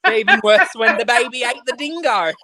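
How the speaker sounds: high, hammed-up Aussie accent; exaggerated Australian joke voice; mock Australian. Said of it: "keep pretending that it's Australian".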